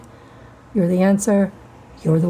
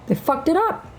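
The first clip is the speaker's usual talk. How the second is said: "They fucked it up" jumped much higher, rising on "up"; mock high repeat; 9 semitones higher than usual. Real, not acted. Mocking men for ruining the world, high rising repeat.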